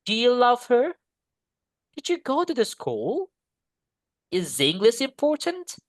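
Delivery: each question climbs at "her", "school", "important"; rising question intonation. Shown as a demonstration. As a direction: lift the final word of every question. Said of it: "close question atau yang interrogatif itu nadanya naik". (nada naik di akhir: "her", "school", "important")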